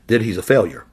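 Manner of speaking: ordinary delivery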